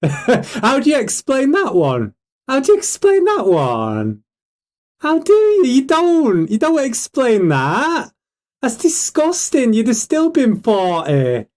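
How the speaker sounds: high, drawn-out disbelief; incredulous outrage. Real, not acted. Streamer confronting the man he is exposing, incredulous. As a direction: demand an answer, pitched high and slow.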